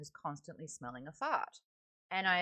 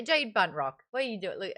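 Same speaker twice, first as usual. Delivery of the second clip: usual speaking voice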